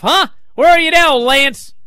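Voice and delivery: loud high mocking call; teasing call-out. Real, not acted. Host needling co-host Lance, loud mocking call. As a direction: call him out, loud and taunting.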